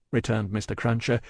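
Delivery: ordinary delivery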